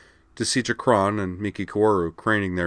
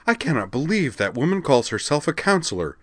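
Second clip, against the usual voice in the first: higher, furious snarl; snarled in anger; 9 semitones higher, 4 dB louder than usual. A narrator's reading. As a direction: spit out the outrage. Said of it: "she snarled".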